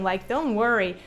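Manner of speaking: normal talking voice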